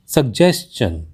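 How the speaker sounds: सजेशन said as "sug-JES-chun"; correct pronunciation. Shown as a demonstration. Teacher gives learners the correct word, carefully pronounced. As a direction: say सजेशन with a hard g before j, then "chun". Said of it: "जबकि इसका सही".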